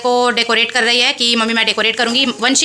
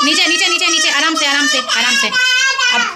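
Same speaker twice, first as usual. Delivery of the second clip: high, urgent careful-now calls; anxious calling out; 12 semitones higher than usual. Real, not acted. Mother urges daughter lighting matches to go gently. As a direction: call out to slow her down, high.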